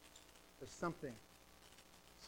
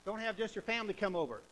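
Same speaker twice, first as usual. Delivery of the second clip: usual speaking voice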